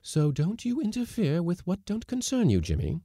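high-pitched firm rebuke; decided. A narrator's reading. Lady cook telling the skipper off, decidedly. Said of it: "said Missus Blossom decidedly".

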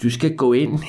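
put-on high, drawn-out old man's voice. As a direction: play the old man slowly handing out a job. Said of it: "den gamle mand, han var sådan".

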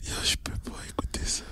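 whispered, fast, can't bear to hear it; disgusted whisper. Real, not acted. Co-host whispering in disgust at the toilet story. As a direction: hush it out in disgust.

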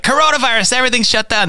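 high, hammed-up alarm; mock news alarm. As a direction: panicky mock announcement. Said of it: "so it's like".

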